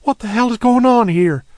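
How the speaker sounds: storming demand. Acted. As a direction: burst out demanding answers. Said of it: "storming out of the motel room".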